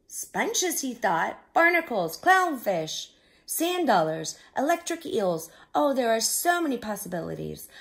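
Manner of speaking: rising delight over each new idea; excited. A narrator's reading. Crab imagines new house possibilities.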